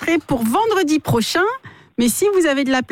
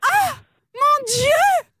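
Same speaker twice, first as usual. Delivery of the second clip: high hammed-up shrieks of fear; badly acted terror; 9 semitones higher, slower than usual. Caller screaming about a man with a shovel, hammed-up and high. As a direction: overplay a telenovela panic. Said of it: "C'est tellement mal joué".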